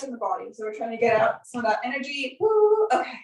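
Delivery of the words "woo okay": excited whoop. Real, not acted. Shaking out energy together.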